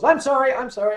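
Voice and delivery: high, loud seagull squawk; gang of seagulls. Acted. The gang of seagulls apologizing, squawked. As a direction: squawk it like a gull in a flock. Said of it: "the gang of seagulls".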